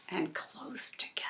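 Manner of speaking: whispered, hushed ending. Acted. Reading the hiding place to Cooper in a whisper. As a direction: hush it like hiding in the plants.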